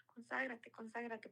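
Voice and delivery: a gentle whispered nudge; whispered urging. Retold or imitated. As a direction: coax them in a whisper. Urging friends to consecrate.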